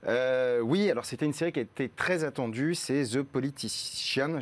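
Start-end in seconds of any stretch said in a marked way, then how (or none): 3.0-4.4 slow, showy English-accented title. Shown as a demonstration. Critic showing off the series title, careful English accent. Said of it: "je vais le dire avec l'accent".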